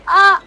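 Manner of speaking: short, loud, high "ah!"; startled scream. Acted. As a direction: cry out in sudden alarm. Game master voices Sasha's alarm.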